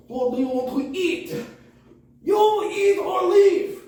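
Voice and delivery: high, slow, loud shout; shouting cook. Retold or imitated. The cook shouting at a customer, high and very loud. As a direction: bark the order to leave. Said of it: "that dude's like".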